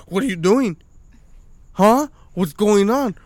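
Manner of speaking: put-on high, loud, prying kid; mock nosy child, loud and high. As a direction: pester like a suspicious little boy. Kid questioning dad, voiced.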